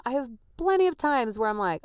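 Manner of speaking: ordinary talk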